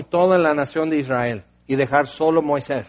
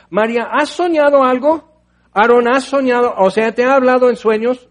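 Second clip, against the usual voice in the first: mock quizzing God; 5 semitones higher, 4 dB louder than usual. God mockingly quizzing Miriam, Aaron.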